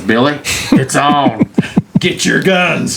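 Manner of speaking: rally your partner with comic zeal; hyped-up watchman. Acted. Watchman to Billy on spotting trespassers, gleeful rallying call.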